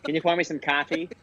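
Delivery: light Boston accent. Shown as a demonstration. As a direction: ask it like a Boston local. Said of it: "that's like a Boston accent".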